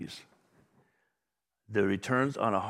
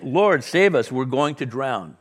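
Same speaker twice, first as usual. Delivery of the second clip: urgent plea; 3 semitones higher, 7 dB louder, slower than usual. A narrator's reading. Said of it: "woke him up, saying".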